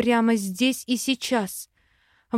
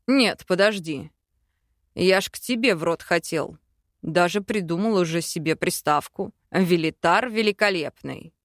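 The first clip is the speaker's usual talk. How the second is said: low male voice; 4 semitones lower than usual. Acted.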